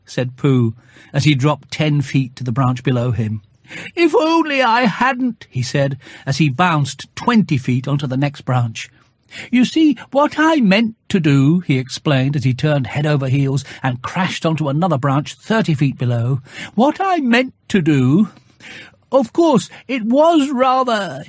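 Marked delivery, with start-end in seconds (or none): 3.9-5.4 high rueful bear voice; Pooh mid-fall. Acted. Falling bear regrets aloud, in a high rueful voice. Bear tumbling through branches. 9.5-11.6 high rueful bear voice; Pooh mid-fall. Acted. Falling bear regrets aloud, in a high rueful voice. 16.8-21.2 high rueful bear voice; Pooh mid-fall. Acted. Falling bear regrets aloud, in a high rueful voice.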